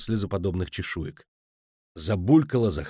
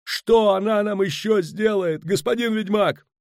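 high, drawn-out desperate demand; panicked, high-pitched shout; frantic yelling; 11 semitones higher, 3 dB louder than usual. A narrator's reading. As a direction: cry out helplessly to someone who should know. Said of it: "орал, размахивая руками Пудлорок".

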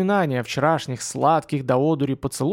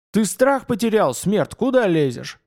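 angry; 6 semitones higher, 3 dB louder than usual. Acted. Vampire snaps at intruder.

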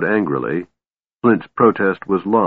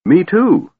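high, loud indignant outburst; indignant cry; 12 semitones higher, 5 dB louder than usual. A narrator's reading. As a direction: pipe up, much higher and louder, offended. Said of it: "cried Tass indignantly".

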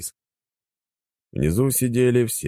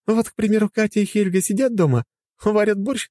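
higher woman's voice, teasing; teasing wife. Acted. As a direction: tease him playfully. (насмешливо)

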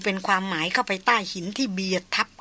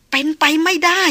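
louder, higher cry of disbelief; raised voice climbing at the end; rising disbelief; 7 semitones higher, 6 dB louder than usual. A narrator's reading. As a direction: push it up into a protest. Disbelief mounts after the murmur.

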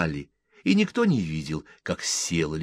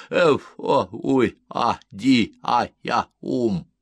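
raised, loud nonsense syllables in disjointed bursts; sleepy babbling resident; 4 semitones higher, 6 dB louder, slower than usual. A narrator's reading. Disturbed sleeper at the window babbles nonsense, loud and sleepy. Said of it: "промямлил сонный жилец".

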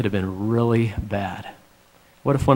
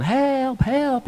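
wife yelling for help; 12 semitones higher than usual. Retold or imitated. Retelling his wife still calling out in the lake, high mock cry. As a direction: pitch the wife's cry high but keep the volume down. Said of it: "Kim's still yelling".

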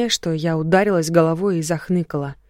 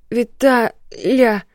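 usual speaking voice

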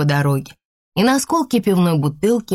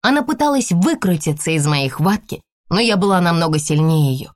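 usual speaking voice

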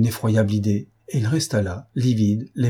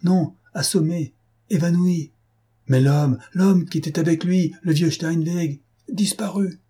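high-pitched, urgent broken phrases; alarmed report; 8 semitones higher than usual. A narrator's reading. Staff reporting the missing witness to the chief, alarmed and high. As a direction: deliver the bad news in urgent bursts.

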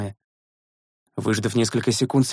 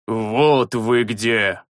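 slow low menacing hiss; 3 semitones lower, much slower than usual. A narrator's reading. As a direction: hiss it at the hidden enemy. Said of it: "прошипел огненный маг".

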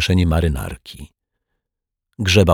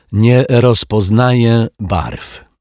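slow, loud, higher self-pity; sighing lament; 5 semitones higher, 6 dB louder, slower than usual. A narrator's reading. Rogue explains his colour blindness, lamenting theatrically. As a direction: moan it out word by word. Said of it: "westchnął Martwiak".

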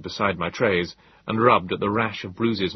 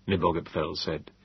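ordinary delivery

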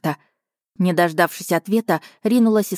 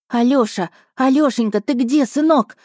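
anxious calling; 6 semitones higher than usual. Acted. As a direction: call out, frightened for your son. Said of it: "с криками".

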